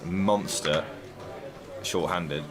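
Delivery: hushed poker commentary. Acted.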